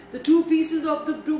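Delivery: ordinary delivery, nothing marked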